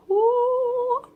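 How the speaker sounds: loud falsetto whoo, singing it out; excited high-pitched whoo; high sung whoo. Real, not acted. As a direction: cheer it up high like a hype call. Hyping number two episode.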